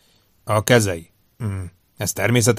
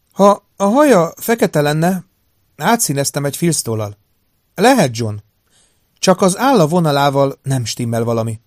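higher, louder female doctor voice; woman doctor, pondering; 6 semitones higher, 6 dB louder than usual. Acted. Doctor studying the picture in her newspaper, higher pondering woman's voice. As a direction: raise the pitch, weighing it as the doctor.

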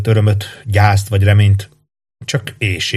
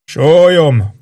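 raised, high-pitched single-word call; calling from behind; 7 semitones higher, 4 dB louder than usual. A narrator's reading. Soldier at the back answers the commander, called out. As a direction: pipe up from the back of the ranks. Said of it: "hallatszott egy hang hátulról".

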